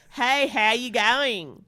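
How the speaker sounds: slow, imitated Australian greeting; Australian accent. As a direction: mimic an Australian drawing out the vowels. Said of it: "they'd be like".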